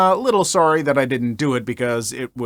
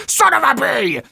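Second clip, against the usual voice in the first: high, far mock yell of a furious dad; hammed-up curse at the dented car; imitating a raging dad; retold angry scream; 9 semitones higher than usual. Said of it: "screaming things like".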